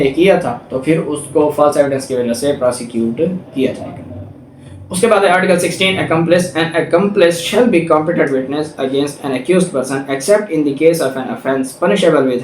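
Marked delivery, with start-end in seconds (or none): none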